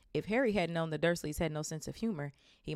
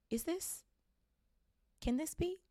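awed disbelief; 12 semitones higher than usual. Acted. Bartender recognizes Harry.

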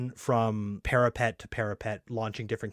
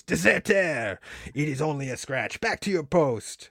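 put-on French sergeant accent; 5 semitones higher than usual. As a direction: shout it like a French officer. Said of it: "he punches him in the face".

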